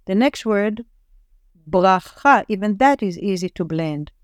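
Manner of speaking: usual speaking voice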